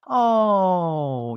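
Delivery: loud, long falling "ohhh"; mock sitcom crowd sympathy, drawn out; studio audience "aww". Sitcom audience reacting to a sad moment, loud mock "aww". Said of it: "the audience would have been like".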